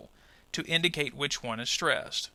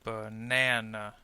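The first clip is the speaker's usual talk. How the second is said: Banana drawn out as one low, slow word; syllables joined slowly; 5 semitones lower, slower than usual. Shown as a demonstration. Teacher showing the three syllables of the word, joined slowly. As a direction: blend Banana slowly with the middle syllable held. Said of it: "Let's group the segments into syllables now".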